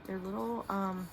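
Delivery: normal talking voice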